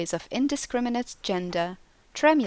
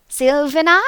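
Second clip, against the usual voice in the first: laughing question; 8 semitones higher, 11 dB louder than usual. A narrator's reading. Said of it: "the voice laughed".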